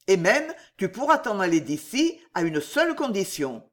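ordinary delivery, nothing marked

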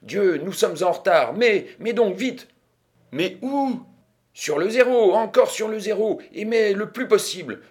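high, loud, impatient urging; urgent, excited. A narrator's reading. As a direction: press them to bet before it is too late. Urging bet before the wheel spins.

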